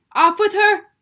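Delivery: very loud, high angry shout; the Queen yelling at Alice again; furious yell. A narrator's reading. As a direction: yell it in rage. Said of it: "Queen began yelling".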